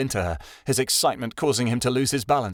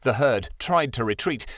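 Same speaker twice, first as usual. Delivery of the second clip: ordinary delivery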